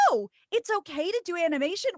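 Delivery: high-pitched mock realization; hammed-up surprised question; imitated pleasant surprise. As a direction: act out celebrities suddenly catching on. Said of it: "all the other celebrities said".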